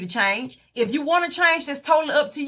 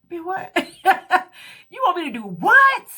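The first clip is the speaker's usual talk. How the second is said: higher, laughing in disbelief; stunned disbelief; 7 semitones higher, 4 dB louder than usual. Real, not acted. Hearing the friend's tummy tuck advice, stunned laughing disbelief. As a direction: laugh it off in shock.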